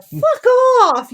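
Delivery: high loud "fuck off"; venting shout. Retold or imitated. Venting alone in the store after customers leave, loud high shout. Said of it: "I'm over there going".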